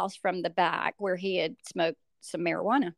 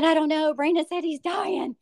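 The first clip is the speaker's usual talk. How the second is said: frightened answer; 9 semitones higher than usual. Retold or imitated. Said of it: "I said".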